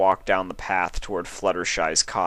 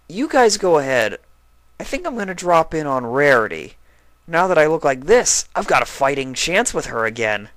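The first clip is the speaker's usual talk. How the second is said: put-on higher, loud eager young dragon; hopeful Spike; 8 semitones higher, 6 dB louder than usual. A narrator's reading. Spike telling friends he'll see Rarity, eager. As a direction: brighten at the thought of Rarity.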